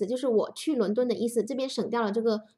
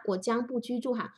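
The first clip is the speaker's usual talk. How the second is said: usual speaking voice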